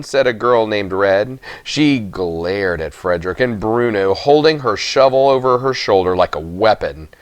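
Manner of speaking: ordinary delivery, nothing marked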